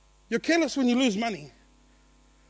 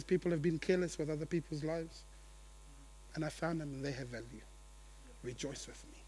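much softer and lower, gentle reassurance; tender God; 7 semitones lower, 14 dB quieter than usual. Acted. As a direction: speak it softly as God comforting the hurt. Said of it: "Just says".

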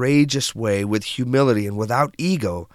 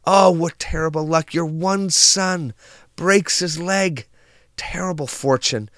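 frantic desperation; 6 semitones higher than usual. Acted. Neighbors lament the son's broken leg, acted desperation. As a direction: wail it lightly as distraught neighbors. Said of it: "frantic, in desperation".

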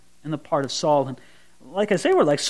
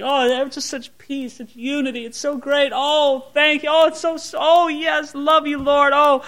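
high, gushing, delighted worship voice; mock blissful praise; 6 semitones higher than usual. Preacher mimicking believers at peace, high gushing praise. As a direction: gush with happy praise, lightly mocking. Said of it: "everything's peaceful spiritually".

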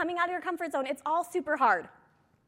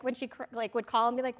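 ordinary delivery

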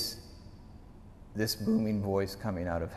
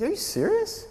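very high mock-incredulous squeak; disbelieving. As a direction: imitate an amazed kid. Retold boy cannot believe it.

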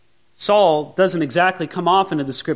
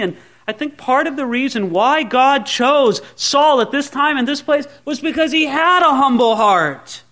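ordinary talk, nothing marked

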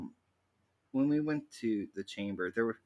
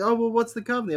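eager question; 12 semitones higher, 6 dB louder, faster than usual. Retold or imitated. Witch asking eagerly.

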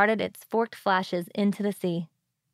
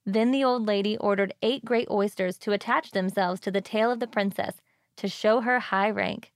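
ordinary delivery, nothing marked

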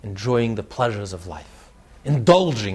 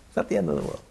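dean whispering; 4 dB quieter, much faster than usual. Retold or imitated. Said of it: "he says".

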